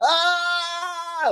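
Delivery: high power-up scream; Dragon Ball power-up scream. Retold or imitated. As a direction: scream like a charging anime fighter. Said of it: "we're leveling up in Dragon Ball".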